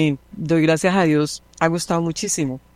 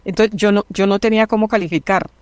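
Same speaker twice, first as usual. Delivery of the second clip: ordinary talk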